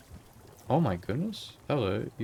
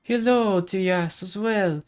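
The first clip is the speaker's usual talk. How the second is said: higher, lilting Irish lady; put-on Irish accent; 7 semitones higher, 6 dB louder, slower than usual. Travelling customer greeting the tea shop, put-on Irish accent. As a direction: greet them in a hammed-up Irish brogue. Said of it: "I'm kind of channeling an Irish type accent".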